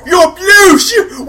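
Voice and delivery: high, loud screaming; acted horrified shout. Gretchen sees the blue orc, high horrified shout. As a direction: shriek it in alarm.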